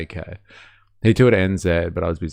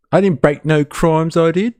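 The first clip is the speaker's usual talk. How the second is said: high mocking voice of Tommy; mock Tommy Robinson; 8 semitones higher than usual. Mocking Tommy impression.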